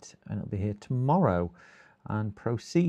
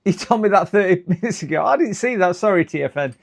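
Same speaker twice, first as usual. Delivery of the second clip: flustered apology; 8 semitones higher, 8 dB louder than usual. Real, not acted. Apologising to a chatter.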